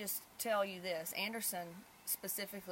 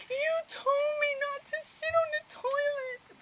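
put-on very high, loud, slow little girl's voice; 10 dB louder than usual. Mother imitating her small daughter in a very high voice. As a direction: squeak it out like a wounded small child. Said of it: "she goes".

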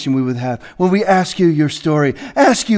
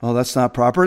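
softer and lower, imitating a prim churchgoer; mock prissy objection; 7 semitones lower, 4 dB quieter than usual. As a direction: parody the offended listener. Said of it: "That's you".

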